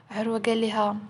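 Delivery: usual speaking voice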